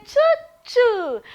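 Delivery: put-on playful high "Choo, choo" for kids; mock train sound. Shown as a demonstration. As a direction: sing out "Choo, choo" like a game. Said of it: "Then you say".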